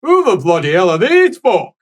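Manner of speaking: hammed-up grumpy priest voice; gruff angry old priest. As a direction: bellow like an outraged old cleric. GM voices angry Father NPC.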